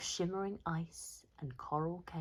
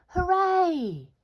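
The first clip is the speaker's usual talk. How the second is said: very high, very loud cheer; cheering shout; 12 semitones higher, 13 dB louder than usual. Acted. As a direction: cheer the whale off to sea. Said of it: "these are the villagers shouting".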